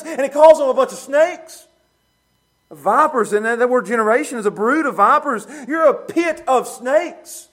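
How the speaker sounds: loud, high preaching that hammers each point; preaching at full force. Acted. Preacher pressing the congregation, full-force preaching. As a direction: preach it hard, pounding the list.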